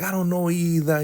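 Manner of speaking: high, put-on innocent voice; playing along. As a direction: feign innocence sweetly. Elder Mother playing along.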